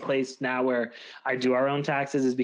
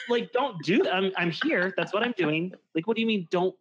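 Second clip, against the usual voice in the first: high, baffled disbelief; mock outraged disbelief; incredulous; 4 semitones higher than usual. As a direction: repeat his words back in shock. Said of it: "And I was like".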